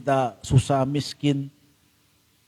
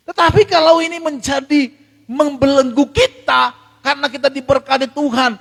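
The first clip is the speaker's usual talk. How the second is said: impassioned sermon climax; fiery preaching; 6 semitones higher, 6 dB louder than usual. Acted. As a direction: thunder the warning at the congregation. Preaching at full force.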